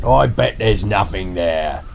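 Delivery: put-on dismissive dad voice; a touch of bluster and scorn; scoffing. A narrator's reading. Dad striding onto the bridge, scoffing. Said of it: "and scoffed".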